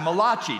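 Malachi said as ma-LAH-chee; mock Italian pronunciation. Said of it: "the only Italian prophet in the Bible".

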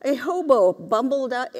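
usual speaking voice

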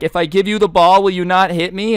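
high, loud frightened plea; scared pleading kid. Retold or imitated. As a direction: beg him not to hit you, voice up high. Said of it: "this guy is like".

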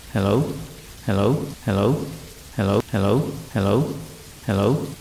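hello repeated like an echo from a short distance away; echoing mimic voice. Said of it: "It repeated".

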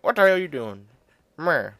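loud high scolding dad voice; fiance's dad. Retold or imitated. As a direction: bellow at the intruder like an angry father. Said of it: "her dad ... be like".

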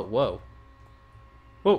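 usual speaking voice

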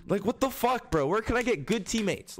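high-pitched irritated complaint; real fed-up rant; exasperated rant. Real, not acted. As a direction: vent it, fed up. Ranting about teammates.

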